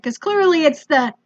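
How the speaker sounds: ordinary delivery, nothing marked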